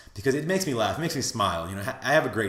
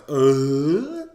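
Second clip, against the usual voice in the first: loud groan rising into a squeal; exasperated groan; 3 semitones higher, 7 dB louder than usual. Retold or imitated. Cabba reacts to being turned down, exasperated rising groan. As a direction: let a groan swell and climb. Frustrated reaction of a retold character.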